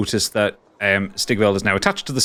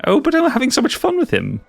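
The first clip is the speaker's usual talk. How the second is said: high, giddy creature delight; creepy giggly voice. Acted. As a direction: coo over him like a mad collector. GM in shopkeeper voice.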